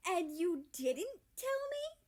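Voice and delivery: high, loud slow disbelief; outraged he was left out; indignant. A narrator's reading. Canada to America, loud outraged disbelief.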